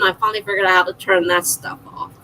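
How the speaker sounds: ordinary talk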